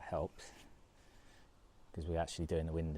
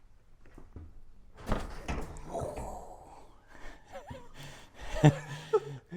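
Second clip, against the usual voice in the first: strained laugh. Real, not acted. Awkward lift, strain.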